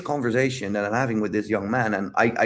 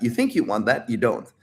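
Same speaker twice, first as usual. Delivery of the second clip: usual speaking voice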